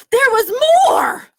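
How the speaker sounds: bursting with news of a funny clown; thrilled. Acted. Gerald tells Piggy about the clown, thrilled.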